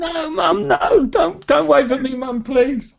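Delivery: put-on high, pleading voice of a mortified kid; embarrassed teenager. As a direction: beg mum to stop waving, squirming with embarrassment. Teen embarrassed by waving mum.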